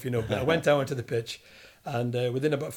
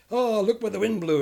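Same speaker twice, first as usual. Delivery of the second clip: mock Bill Shankly, higher and louder, teasing; Shankly greeting; 8 semitones higher, 6 dB louder than usual. Ex-footballer imitating Shankly greeting him, higher and louder. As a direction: tease the small lad in the old boss's voice. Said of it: "he goes".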